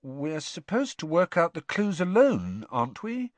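high, lightly questioning; carefully casual. A narrator's reading. Harry questions Bagman about working alone, carefully casual. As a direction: keep any accusation out of it. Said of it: "careful to keep his voice casual".

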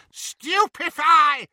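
drawn-out high loud scream; screamed spell. Acted. Bellatrix stunning the snatchers, screamed curse. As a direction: hurl the curse at full voice. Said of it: "she screamed".

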